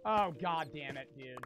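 usual speaking voice

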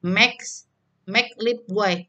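normal talking voice

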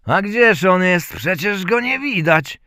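loud, high-pitched disbelieving question; incredulous gentleman. A narrator's reading. Gentleman demands to see the son. (zdumiony pan, głośno i wysoko)

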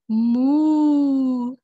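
loud, long mooing cow. Acted. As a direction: moo big for the little ones. Cow puppet moos.

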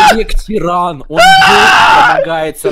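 mock shout. Hammed-up complaint to chat.

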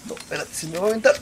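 loud rising panic; panicking gamer. Real, not acted. Spider attacking in game.

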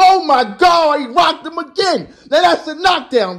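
sudden high shout at the knockdown; excited shout. Real, not acted. Watching the fight, shouting as Martin's punch rocks Ali. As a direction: yell it as the punch lands. Knockdown seen live on stream.

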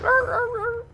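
very high yapping arr arr arr; dolphin noise. Retold or imitated. Mocking a diving enemy with high dolphin-like yaps. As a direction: yap like a dolphin, then a dog. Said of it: "He's like".

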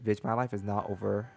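ordinary talk, nothing marked

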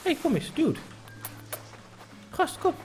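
high calls to a wandering pig; calling out. Real, not acted. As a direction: call the piglet back, up high. (hoog roepen naar het biggetje)

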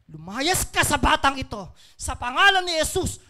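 loud, forceful exorcism command; commanding shout. Retold or imitated. Preacher retelling prayer over sick child, shouted command to demon. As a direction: burst out and order the demon away.

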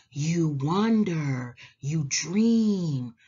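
plain reading voice

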